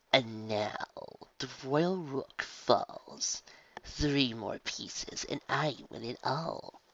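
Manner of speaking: soft, very slow sinister gloat; gloating villain. Acted. Villain gloating over the chess game, slow soft voice. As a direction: savour each word.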